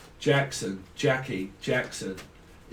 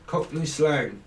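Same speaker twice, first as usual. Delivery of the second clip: usual speaking voice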